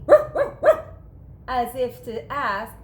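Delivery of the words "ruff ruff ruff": high, loud yappy barks; happy dog barks; excited barking. A narrator's reading. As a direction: bark like an eager dog. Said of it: "He barked excitedly".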